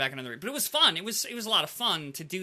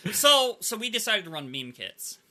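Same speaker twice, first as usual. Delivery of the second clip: normal talking voice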